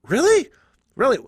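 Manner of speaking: high, loud incredulous really; mock disbelief. Playing John Goodman hearing the offer, mock disbelief. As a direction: pretend to be stunned.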